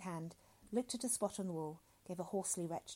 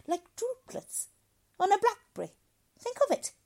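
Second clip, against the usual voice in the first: high, loud stern lecture; lecturing doctor; 8 semitones higher, 10 dB louder than usual. A narrator's reading. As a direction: lecture him from above. Dr Hitz lectures loudly.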